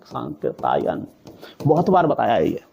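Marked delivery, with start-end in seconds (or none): 0.0-1.0 सांकृतायन drawn out slowly and low, syllable by syllable; सांकृतायन said slowly and deliberately; slow careful spelling-out. Shown as a demonstration. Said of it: "Rahul सांकृतायन होता है".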